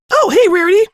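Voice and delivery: excited squeal. Acted. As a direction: greet her like a hyper kid. Pink girl greets excitedly.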